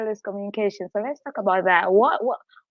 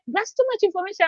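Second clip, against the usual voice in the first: mock friend; 9 semitones higher, faster than usual. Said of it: "they're like".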